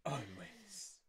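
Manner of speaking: soft, low mock-dramatic echo; hushed teasing imitation. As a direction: imitate a melodramatic lover softly. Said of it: "It's so dramatic".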